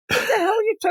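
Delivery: high-pitched mock indignation; put-on high voice of protest; mock Mary Jane outburst. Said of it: "And the Mary Jane's like".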